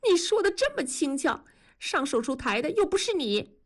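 high-pitched, acted tearful whine; whimpering. A narrator's reading. Wife sobs at husband making light of surgery, whimpering. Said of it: "文丽呜咽着说".

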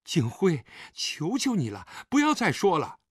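acted tearful begging; tearful plea. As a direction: beg him to stop, near tears. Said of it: "陈秋月已经带着哭音了".

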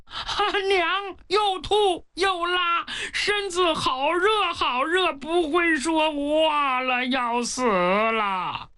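high, slow, tearful wail drawn out at the end; crying, sobbing report. Acted. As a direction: cry it out like a desperate child. Pang Hu describes dying mother. (哭哭啼啼拖长声)

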